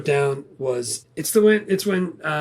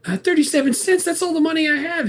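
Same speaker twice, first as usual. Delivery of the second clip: much higher, put-on voice for a quoted character; movie character protesting; 13 semitones higher than usual. Guerrilla pleads over Monopoly money, high mock protest.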